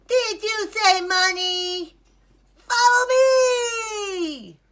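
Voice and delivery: high, loud eager duck cry; excited duck. Acted. Duck hears about money and calls Ilyas, excited yell. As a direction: shout it like a thrilled cartoon duck.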